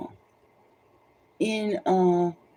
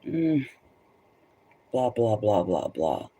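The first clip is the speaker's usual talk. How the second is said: dismissive blah blahs sinking much lower; mocking blah blah; 8 semitones lower, slower than usual. Inner thoughts about an aggressive stranger, mocking blah blah. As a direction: mutter the blahs with contempt. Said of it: "Even though you're thinking".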